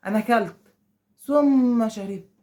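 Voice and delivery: "ثم" stretched long, then "شربت" eased out; unhurried demo of a delay between acts; slow and drawn out. Shown as a demonstration. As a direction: drag the word "ثم" to show a slow gap. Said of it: "ثم دي واحد باله طويل".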